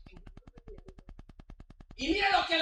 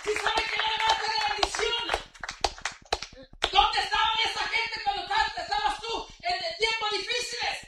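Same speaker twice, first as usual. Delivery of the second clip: loud, high, fervent pulpit preaching; preaching at full force; 8 semitones higher, 4 dB louder than usual. Acted. Pastor rousing the congregation, loud fervent preaching. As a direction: drive the message home to the pews. Climax of the sermon.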